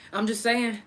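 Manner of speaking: ordinary delivery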